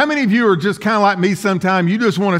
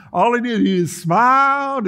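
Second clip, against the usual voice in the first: exaggerated smiley impression; Gomer Pyle; 6 semitones higher than usual. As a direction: stretch it through a big smile. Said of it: "You remember old Gomer Pyle".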